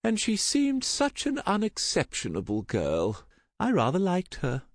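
put-on high, wistful older relation's voice; mildly plaintive, high-pitched; sighing mother. A narrator's reading. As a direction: defend her gently, in a high voice. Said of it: "she sighed".

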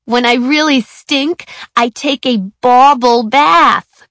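class clown voice. A narrator's reading. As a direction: stretch the joke out slowly. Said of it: "George Brennan shouted out".

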